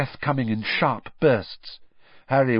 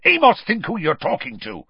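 high, loud rebuke; very angry; 8 semitones higher, 5 dB louder than usual. A narrator's reading. Father figure rebuking the official, very angry. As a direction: snap indignantly at a colleague. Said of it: "very angrily".

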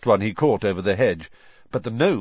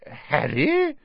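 higher, puzzled rising question; blank; 7 semitones higher than usual. A narrator's reading. Husband puzzled by his wife's news, blank question. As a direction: query the name as if it means nothing. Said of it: "said Mister Weasley blankly".

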